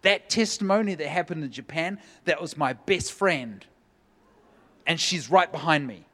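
woman leaping up in the crowd, excited outburst. Retold or imitated. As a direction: blurt it out as if leaping from the seat. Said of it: "she jumps in her seat and she goes".

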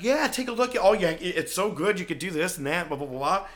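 high, loud, mock-excited gushing; eager dumb show-off. Clueless owner showing off his car to Nacho, mock-excited. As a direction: gush in a mocking, over-eager high voice. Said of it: "His dumb ass is like".

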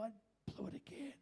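whispered admission of failure; hushed, contrite imitation; acted confession. Acts out confessing to God.

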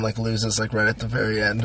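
normal talking voice

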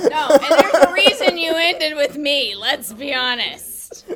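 laughing tease. Real, not acted. Joking banter at the mic.

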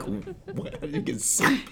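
high, puzzled squeak; baffled, voice jumping up; confused surprise. Retold or imitated. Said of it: "I was like".

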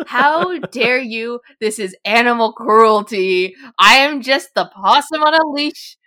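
loud, hammed-up indignation; big, slow-starting comic outburst; mock outrage. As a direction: protest theatrically, as if gravely wronged. Mock protest at being kept guessing.

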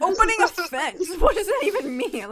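high, laughing question; puzzled laugh at the wording; laughing confusion. Real, not acted. Mocking childhood story wording.

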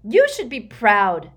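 loud, slow, hard rebuke; ruthless. A narrator's reading. Bellatrix rebuking her weeping sister, ruthless. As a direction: raise the voice over her grief, merciless. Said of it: "said Bellatrix ruthlessly".

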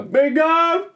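high excited shout; shouting bingo. Retold or imitated. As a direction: call out bingo. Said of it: "nervous about actually having to stand up and go".

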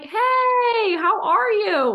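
extra lifted greeting. Shown as a demonstration. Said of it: "change the inflection in your voice from".

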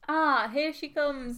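slow, posh announcing; old-timey voice. Acted. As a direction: announce her arrival grandly. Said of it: "our impression of the great song".

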